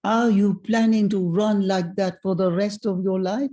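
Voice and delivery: playful imitation of a French accent. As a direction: put on an old Frenchman's accent. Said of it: "he said to me in a French accent".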